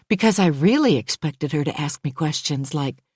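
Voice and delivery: plain reading voice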